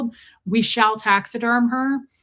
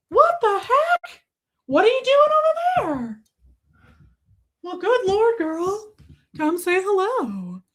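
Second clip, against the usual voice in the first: put-on much higher cooing baby talk to the dog; doting pet voice; 5 semitones higher than usual. Talking to her little dog, high doting coo.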